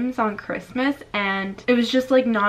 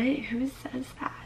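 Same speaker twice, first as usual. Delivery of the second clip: disbelieving whisper; 3 semitones higher, 11 dB quieter than usual. Real, not acted. Reacting in disbelief to hate comment.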